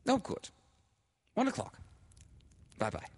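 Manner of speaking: high, pleased telephone voice; delighted, high-voiced confirmation; excited phone chatter. A narrator's reading. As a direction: wrap up the call brightly, pitched high. Laura finishes her happy telephone call.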